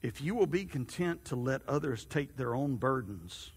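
low, soft and unhurried; God's voice. A narrator's reading. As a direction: speak as a gentle, calm God. Narrator voices God gently.

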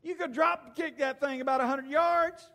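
put-on high, loud mother setting her kid straight; exasperated mama. Mama tells her frightened son how tiny the dog is, hollering. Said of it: "then his mama told him".

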